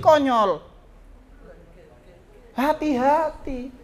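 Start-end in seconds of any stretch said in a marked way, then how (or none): 2.6-3.7 gentle warning. Real, not acted. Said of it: "ngomongnya keras apa lembut ya? Lembut kan?".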